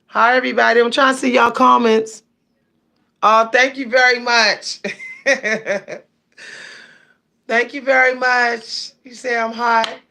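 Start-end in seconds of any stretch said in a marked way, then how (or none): 4.8-6.0 flattered laugh. Real, not acted. Laughs at a viewer's compliment.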